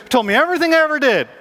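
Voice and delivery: high, loud acted amazement; excited testimony. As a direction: burst out with the astonishing news. Acts woman excitedly telling townsmen.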